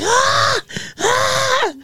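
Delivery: high, stunned ah after a slap; imitating a shocked high-pitched cry; stunned gasp. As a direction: gasp in high shock, like a kid who just got hit. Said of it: "standing there like".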